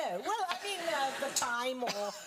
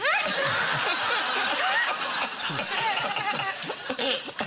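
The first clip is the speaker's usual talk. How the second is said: big laugh; 11 semitones higher than usual. Real, not acted. Laughs at horrible Arnold memory.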